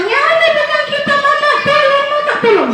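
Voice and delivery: very high screaming for mama; panicked crying. Acted. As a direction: wail for help while struggling. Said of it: "Dia merontak".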